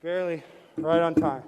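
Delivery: out of breath from rushing in; panting between words; after a workout. Real, not acted. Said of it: "Let me catch my breath".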